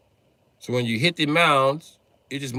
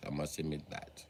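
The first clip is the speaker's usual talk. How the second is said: ordinary talk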